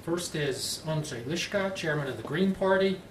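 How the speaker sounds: ordinary delivery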